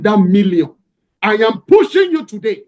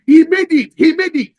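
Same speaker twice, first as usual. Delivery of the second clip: high, loud cheering of he made it; excited crowd shouting; 8 semitones higher, 5 dB louder than usual. Retold or imitated. Crowd by the pool shouting to the swimmer, high and loud. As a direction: shout it out like a crowd watching a winner reach the side. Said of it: "they started screaming".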